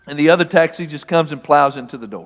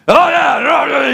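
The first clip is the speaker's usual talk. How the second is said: mocking retort; 8 semitones higher than usual. Drivers mocking his refusal.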